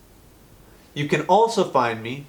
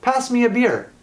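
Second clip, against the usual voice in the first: high, bright "Pass me a beer" with a falling end; correct command; 11 semitones higher, 3 dB louder than usual. Shown as a demonstration. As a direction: say it as a cheery order.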